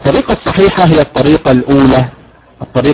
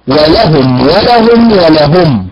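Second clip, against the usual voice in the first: teacher cycles through the ways of ولهم; three stress variants; slower than usual. Shown as a demonstration. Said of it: "لها ثلاث طرق في الأداء".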